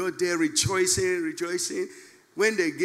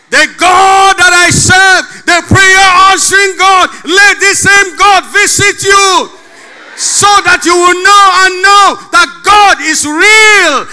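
preaching at full force; 12 semitones higher, 17 dB louder than usual. Acted. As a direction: thunder it to the congregation.

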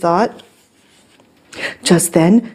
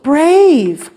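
"brave" stretched long and higher; stress on brave; 8 semitones higher, 4 dB louder than usual. A narrator's reading. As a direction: draw out "brave" proudly. Story's triumphant turn.

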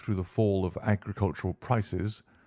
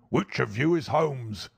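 put-on angry old doctor; 4 semitones higher than usual. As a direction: demand it like a menacing old villain.

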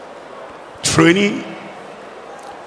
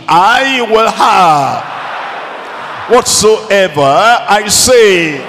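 slow, raised, emphatic declaration; with conviction; 3 semitones higher, slower than usual. Shown as a demonstration. Preacher urging congregation to repeat the promise, with conviction. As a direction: proclaim it with full conviction, drawing out the words. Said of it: "Say it with conviction".

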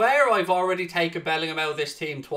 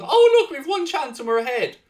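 mocking; 9 semitones higher, 7 dB louder than usual. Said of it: "mocking the opponent's luck".

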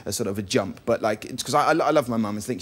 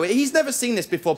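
ordinary talk

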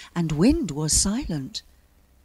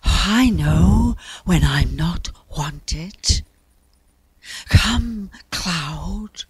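slow, breathy, gusting voice; huffy wind; 5 dB louder, much slower than usual. A narrator's reading. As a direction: puff out the words, offended, like a gale. Wind's huffy voice.

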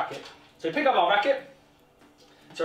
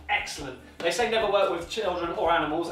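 usual speaking voice

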